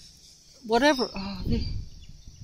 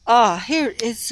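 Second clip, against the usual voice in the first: ordinary talk, nothing marked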